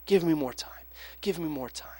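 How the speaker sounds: soft pleading that fades to almost nothing; quiet begging. Retold or imitated. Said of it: "he's just begging his master".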